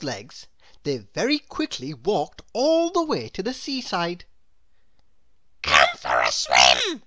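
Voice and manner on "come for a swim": loud, tiny character voice; crying out. A narrator's reading. Mister Small invites Mister Tall to swim, crying out. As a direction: call out eagerly as a little fellow. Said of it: "cried Mister Small".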